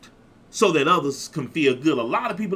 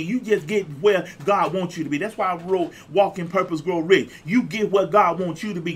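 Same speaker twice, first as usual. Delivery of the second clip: ordinary talk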